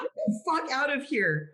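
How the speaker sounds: high, laughing get out; playful shout. Real, not acted. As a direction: toss it back in mock outrage. Playful retort after protest.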